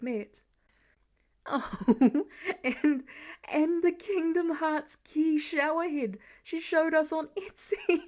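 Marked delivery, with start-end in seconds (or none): 1.4-8.0 gasping words through laughter; laughing fit. A narrator's reading. Laura to Clint about their daughter's ideas, laughing. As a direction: push the words out between laughs. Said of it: "Laura gasped around her laughing fit".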